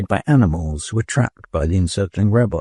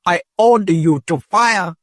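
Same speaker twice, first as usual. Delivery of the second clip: much higher, shrill urgent order; shrill command; 10 semitones higher, 3 dB louder than usual. A narrator's reading. Dodath shrilly ordering the robots to fire. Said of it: "his voice shrill with urgency".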